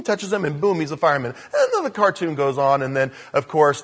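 usual speaking voice

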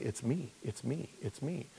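very soft, repeated murmur; hushed hint. Acted. Preacher voicing the Lord's hidden clue, very soft. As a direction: drop right down, softly insistent.

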